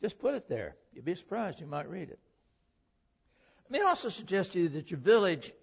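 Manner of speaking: normal talking voice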